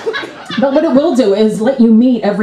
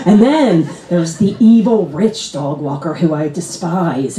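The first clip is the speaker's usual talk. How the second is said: ordinary delivery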